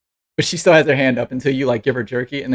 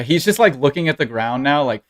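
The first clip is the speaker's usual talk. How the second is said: ordinary talk, nothing marked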